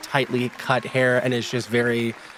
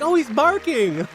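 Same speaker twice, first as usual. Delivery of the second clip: high, louder cooing; cooing townsfolk; 3 dB louder than usual. Acted. Townsfolk coo at the barking dog, high.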